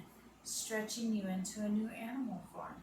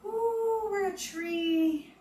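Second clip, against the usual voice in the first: much higher and louder, delighted; excited cheer; 11 semitones higher, 8 dB louder, much slower than usual. Real, not acted. Yoga teacher delighted with the kids' trees, excited. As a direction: cheer the class on with a big ooh.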